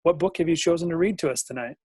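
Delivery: ordinary delivery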